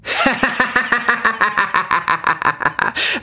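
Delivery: very high rising maniac cackle; manic cackle. A narrator's reading. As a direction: cackle like a lemon gone mad. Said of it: "brach sie in manisches Lachen aus".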